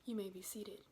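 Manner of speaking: unvoiced whispered line. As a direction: breathe it as an aside. Judge's aside, whispered.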